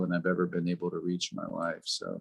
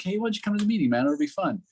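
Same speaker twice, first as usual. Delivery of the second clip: super nonchalant; 9 semitones higher, faster than usual. Retold or imitated. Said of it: "You know, like, super nonchalant".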